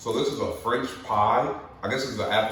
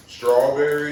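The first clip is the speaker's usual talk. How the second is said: louder, higher flavor call; louder announcing; 5 semitones higher, 9 dB louder, slower than usual. Real, not acted.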